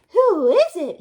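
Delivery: high, loud sugary granny; wolf faking Granny's voice; sweetest granny voice. Acted. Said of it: "in the sweetest granny voice".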